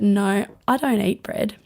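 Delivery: ordinary talk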